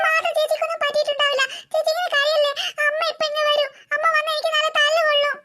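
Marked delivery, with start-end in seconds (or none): none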